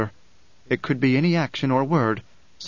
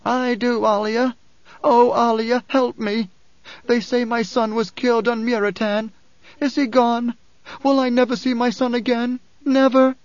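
high, desperate pleading, building louder; anguished cry; 10 semitones higher, 4 dB louder than usual. A narrator's reading. Mother begs Alia about her killed son, crying out. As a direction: plead for your lost son, high and urgent. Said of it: "the woman cried".

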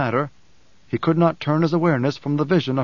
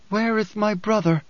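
higher, urgent sister's voice; urgent Alia; 7 semitones higher than usual. A narrator's reading. Alia rushes in looking for her brother, higher and urgent. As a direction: demand it, searching the room.

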